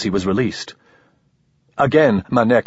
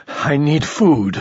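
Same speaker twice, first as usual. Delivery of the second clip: more reasonable tone; 5 semitones higher, slower than usual. A narrator's reading. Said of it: "in a more reasonable tone".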